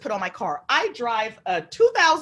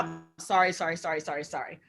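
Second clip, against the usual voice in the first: low, trailing string of apologies; muttered apology; 6 semitones lower, 6 dB quieter than usual. Retold or imitated. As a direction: imitate apologies tumbling out ever softer. Voicing manager's repeated apology.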